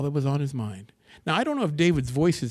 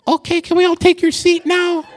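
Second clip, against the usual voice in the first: put-on squeaky high teen voice, much louder, with a laugh; Andrew's unchanged voice; 12 dB louder than usual. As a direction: mimic a boy whose voice hasn't broken yet. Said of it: "when Andrew's voice had not changed".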